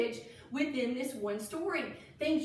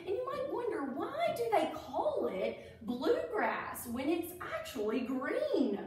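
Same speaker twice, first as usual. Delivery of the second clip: ordinary delivery, nothing marked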